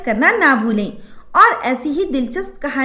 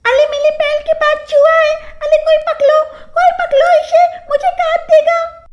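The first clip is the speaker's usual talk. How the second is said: high panicked lisping cries; lisping shriek; 3 dB louder than usual. Acted. Bride shrieking at a toy mouse, high and lisping. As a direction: squeal it in babyish panic. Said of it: "पायल चिल्ला उठी".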